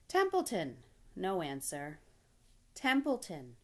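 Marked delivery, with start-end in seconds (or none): 0.1-0.8 loud, high call dropping at the end. Acted. Calling again, no answer. 2.7-3.6 raised call with falling end. Acted. Third call, no answer.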